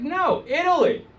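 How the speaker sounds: high-pitched quoted woman's reply; imitated wife. Wife correcting the New Yorker, imitated high voice. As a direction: act out the correction, pitched well up. Wife's correction acted in story.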